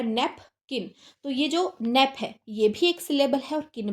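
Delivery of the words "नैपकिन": नैपकिन broken into नैप, pause, किन; नैपकिन in two separate beats; split into syllables. Shown as a demonstration. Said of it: "दो चीज़ों में तोड़ना पड़ रहा है".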